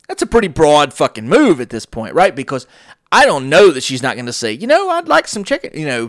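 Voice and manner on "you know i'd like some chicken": high, put-on old customer voice; mock old lady. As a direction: play the sweet old lady ordering. Imagined customer request voiced.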